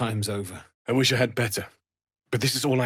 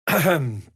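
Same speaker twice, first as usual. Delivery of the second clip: pointed, louder ahem; throat clearing; 4 semitones higher, 5 dB louder than usual. Acted. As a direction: clear your throat for attention. Clears throat before speaking.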